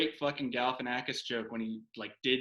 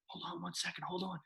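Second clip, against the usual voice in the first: hushed, higher aside off the phone; quiet aside; 4 semitones higher, 12 dB quieter, faster than usual. Retold or imitated. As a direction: hold them off quietly, higher up. Aside while taking phone call.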